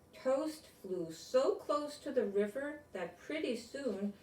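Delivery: bright, storybook read-aloud voice; reading to children. Acted. Grown-up reading a picture book to kids, storybook voice. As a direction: read it like bedtime story time.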